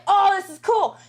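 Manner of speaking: mock thrill at the robot; excited. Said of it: "I was like".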